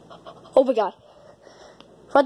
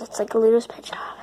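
whisper; 8 semitones lower, 5 dB quieter, faster than usual. Acted. Aside under breath.

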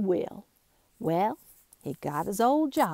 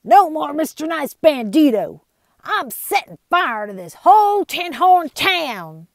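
loud, high threatening yell; angry outlaw shout; 8 semitones higher, 9 dB louder than usual. Acted. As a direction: threaten the whole town. Bart threatens to burn town.

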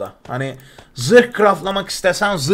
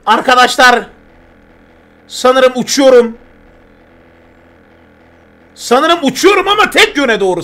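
excited announcement; 5 semitones higher, 5 dB louder than usual. Real, not acted. Excited first flight to chat.

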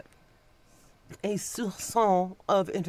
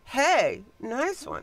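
sarcastic; 8 semitones higher, much slower than usual. Retold or imitated. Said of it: "point at and go".

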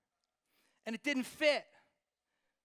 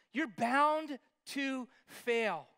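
slow emphatic; 4 semitones higher, 6 dB louder, much slower than usual. Real, not acted.

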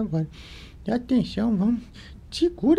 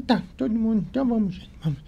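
plain reading voice

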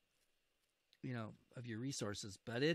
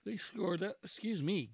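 talking through a yawn; 7 semitones higher, 5 dB louder than usual. Real, not acted. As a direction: yawn mid-sentence. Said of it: "Gosh, I'm yawning like crazy".

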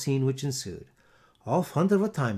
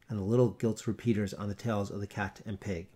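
usual speaking voice

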